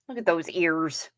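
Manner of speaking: ordinary talk, nothing marked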